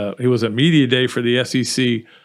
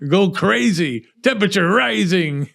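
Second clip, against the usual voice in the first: raised, mock monster voice; Hulk; 7 semitones higher than usual. Host likens his press box rage to a monster, hammed-up. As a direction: play the raging brute, half in jest. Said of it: "Me Hulk".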